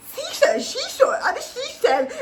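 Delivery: mocking her stumble. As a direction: imitate her fumbling the tongue twister. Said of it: "Guys, did you see her?".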